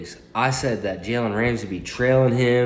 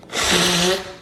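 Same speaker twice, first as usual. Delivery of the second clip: short laugh; 10 semitones higher than usual. Real, not acted. Laughs at his phone setup.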